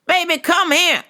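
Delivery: high-pitched mock church lady calling out; calling baby. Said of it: "she goes".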